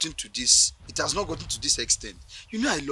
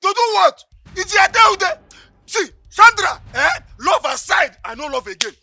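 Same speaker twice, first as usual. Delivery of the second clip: far higher and louder, screaming in rage; furious boyfriend screaming for his money back; furious shouting; 13 semitones higher, 13 dB louder than usual. Acted. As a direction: yell the demand at the top of your voice.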